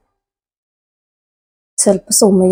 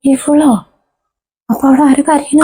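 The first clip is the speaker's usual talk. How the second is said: shocked realisation; 4 semitones higher than usual. Acted. Mother realises her own daughter cries.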